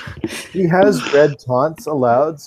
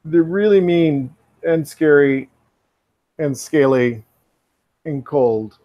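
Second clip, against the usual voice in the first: [name]; 2 semitones higher than usual. Acted. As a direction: haltingly warn them, pausing between phrases. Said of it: "you hear [name]'s voice again".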